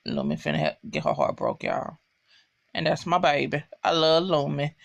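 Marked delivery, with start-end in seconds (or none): 0.1-2.0 low dramatic whisper, no voice at all; whispered warning. Real, not acted. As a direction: whisper it like sad gossip. Sad news about favourite character.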